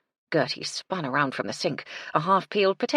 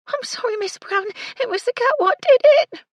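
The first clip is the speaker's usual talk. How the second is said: wailing; 10 dB louder than usual. A narrator's reading. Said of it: "Doris's words ended in a wail".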